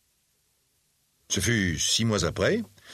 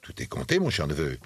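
low, measured delivery; measured words; 7 semitones lower, 3 dB quieter than usual. A narrator's reading. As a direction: weigh each word carefully, pitched low. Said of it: "il dit avec lenteur, en mesurant ses paroles". (paroles mesurées, d'une voix basse)

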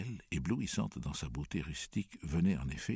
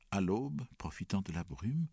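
ordinary delivery

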